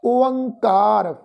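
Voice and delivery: ਓਅੰਕਾਰ slowly as o-ang-kaar; correct pronunciation demo. Shown as a demonstration. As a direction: draw out ਓਅੰਕਾਰ with the nasal ang. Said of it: "ਅਸੀਂ ਇਸ ਦਾ ਉਚਾਰਨ ਕਰਾਂਗੇ". (ਹੌਲੀ, ਸ਼ੁੱਧ ਓਅੰਕਾਰ)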